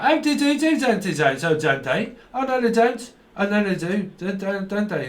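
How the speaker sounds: high mock Scouse "don't they"; a lightly hammed-up Scouse voice; mock Scouse accent. Imitating how people from Scouse speak, mock Scouse accent. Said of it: "that's how they speak up there".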